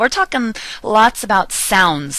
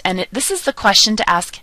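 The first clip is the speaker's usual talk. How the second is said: ordinary delivery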